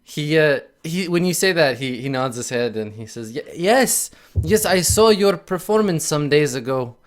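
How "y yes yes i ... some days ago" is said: raised, slightly higher nervous stammer; lightly voiced timid little man; nervous halfling. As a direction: stammer it out, eager and unsure.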